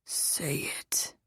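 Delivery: low, very soft breathy groan. A narrator's reading. Lover urging her as he kisses behind her ear, groaning softly. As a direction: groan it under your breath. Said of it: "he groaned as he kissed me".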